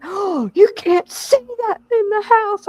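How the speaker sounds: high, slow mock-prissy husband; hammed-up shocked husband; imitating husband. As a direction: mimic him all scandalized. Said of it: "he's like".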